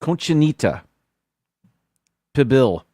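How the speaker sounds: Conchinita with the extra ni syllable, then pibil falling; corrected pronunciation. Real, not acted. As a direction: fix the name as Conchinita pibil, settling on it. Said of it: "I don't know where the accent is".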